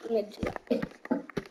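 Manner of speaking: breathy under-the-breath whisper, slow; whispered aside. Real, not acted. Vlogger whispering a grumble to viewers about that habit, hushed. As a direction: mutter it to yourself, barely voiced.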